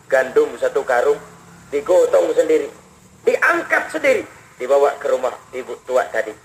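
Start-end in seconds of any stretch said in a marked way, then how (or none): none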